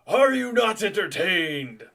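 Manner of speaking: loud, slow, theatrical bellow; showman's shout. Acted. Captain in the spotlight before attacking, grand slow shout. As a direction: bellow it to a whole arena.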